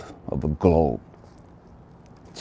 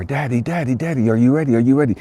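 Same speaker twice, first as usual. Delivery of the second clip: put-on high, excited child calling; eager little boy; 6 semitones higher, faster than usual. Little boy knocking on dad's door, high and eager. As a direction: call through the door like an eager kid. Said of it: "Little boy comes knocking".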